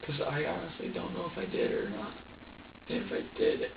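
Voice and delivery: high, strained voice close to breaking; real anguish. Real, not acted. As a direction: voice climbing and giving out mid-confession. Distressed confession about hurting baby.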